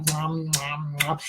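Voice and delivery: soft, low munching sounds like a cow at cud; cow chewing. Retold or imitated. Imitating a cow chewing cud, soft and low. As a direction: chomp it out quietly like a cow. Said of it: "like cows do cud".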